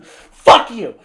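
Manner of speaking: high, loud burst of disgust; exasperated outburst. Real, not acted. Reviewer cursing the Joker's punchline, loudly fed up. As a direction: spit it out loudly at the film.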